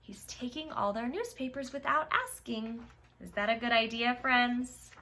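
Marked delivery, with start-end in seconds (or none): none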